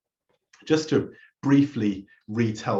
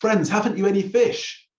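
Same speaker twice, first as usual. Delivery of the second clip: raised, higher call across the water; 9 semitones higher, 4 dB louder than usual. Retold or imitated. Jesus calling to disciples on boat, raised call. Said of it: "he calls out to them".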